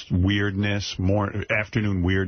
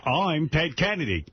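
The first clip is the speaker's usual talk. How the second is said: Ted Kennedy impression; 7 semitones higher, slower than usual. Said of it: "We got a Ted Kennedy".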